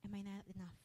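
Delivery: a hint of God's quiet rebuke, with a long pause; God speaking softly. Pastor voicing God's soft question to her. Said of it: "Sabi sa akin ng Lord".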